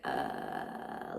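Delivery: low creaky crackle; vocal fry. Shown as a demonstration. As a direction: let the voice pop and crackle down low. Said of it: "distinct creaky kind of sound".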